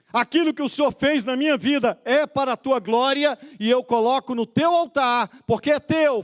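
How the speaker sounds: high, building declaration to God; full-force preaching. Real, not acted. Preacher modelling a believer's offering to God, full-force preaching.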